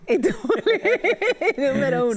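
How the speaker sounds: loud, high laughter through the words; laughing. Real, not acted. Interviewer laughing at the guest's bullying joke, real laughter. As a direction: repeat it while cracking up.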